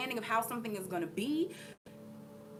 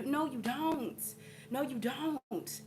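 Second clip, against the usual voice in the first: slow, firm no, you don't; slowly spaced, settling the matter; 2 semitones higher, 4 dB quieter, much slower than usual. Real, not acted. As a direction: lay it down slowly, no argument. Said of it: "firm rebuttal".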